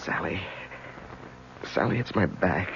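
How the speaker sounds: pained urgency. Acted. Sudden back pain attack.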